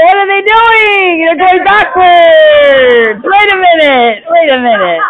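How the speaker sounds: high, drawn-out wail of disbelief; loud wailing from the stands, easing off; dismayed spectators. Acted. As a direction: stretch each cry out in mock horror. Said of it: "they're like".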